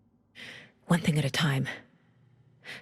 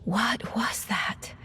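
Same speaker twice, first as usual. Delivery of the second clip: very slow, hushed, shaken; stunned whisper; 3 semitones higher, much slower than usual. Acted. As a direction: let it fall out slowly in a whisper. Stunned after mental connection.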